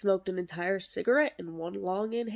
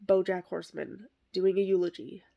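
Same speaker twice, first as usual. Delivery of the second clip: ordinary delivery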